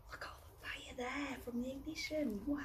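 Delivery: gasp, then whisper in wonder; awed whisper. Acted. Baby Bear whispering in awe at the rocket fire.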